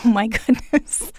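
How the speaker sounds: said through a laugh; amused, chuckling reaction. Real, not acted. Host reacts to the marijuana story.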